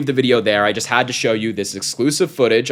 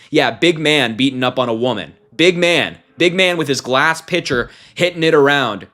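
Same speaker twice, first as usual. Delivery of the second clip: scornful, raised voice taunt; mocking sneer; 4 semitones higher, 4 dB louder than usual. Real, not acted. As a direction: sneer at the big man. Mocking the attacker.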